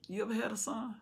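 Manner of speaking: hushed, probing question; soft pointed question. Acted. Preacher quietly asks Joab.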